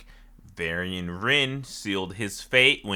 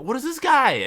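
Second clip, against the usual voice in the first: high confused squeal; 14 semitones higher, 4 dB louder than usual. Retold or imitated. Confused quote.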